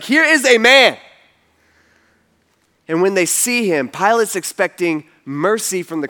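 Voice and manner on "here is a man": loud, higher announcement; raised-voice public proclamation; proclaiming to crowd. Retold or imitated. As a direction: present him to a whole crowd. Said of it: "he tells the crowd".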